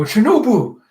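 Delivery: high, loud call of a name; alarmed cry. Acted. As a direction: cry out in acted distress. Momo cries out for Shinobu.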